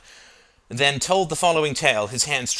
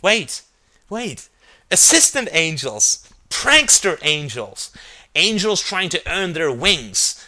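high, excited burst of ideas; sudden inspiration; 5 semitones higher, 6 dB louder, slower than usual. A narrator's reading. Justin hitting on the angel concept, excited. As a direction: announce each idea slowly and loudly as it strikes.

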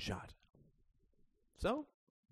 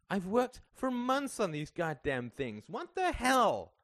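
high, slow, indignant outburst; wounded, building to a loud cry; angry protest; 11 semitones higher, 6 dB louder, slower than usual. A narrator's reading. Greta protests in a quarrel.

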